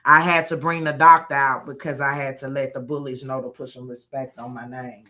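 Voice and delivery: usual speaking voice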